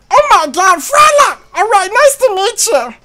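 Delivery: high, loud cartoon voice; Meatwad impression. Player voices homeboy suggesting a nickname, high Meatwad voice.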